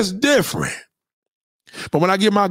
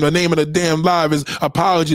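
ordinary talk

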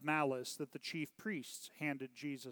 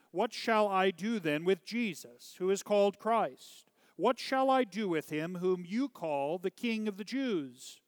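higher, louder public question; loud appeal; 5 semitones higher, 5 dB louder than usual. A narrator's reading. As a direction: appeal loudly to the crowd. Pilate appeals to the crowd.

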